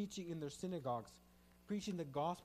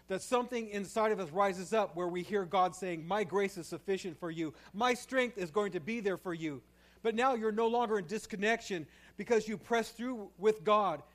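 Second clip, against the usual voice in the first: louder, higher fervent sermon delivery; full-force preaching; 4 semitones higher, 9 dB louder than usual. Acted. Pastor urging the congregation out of disconnection, fervent preaching. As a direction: drive the point home to the congregation.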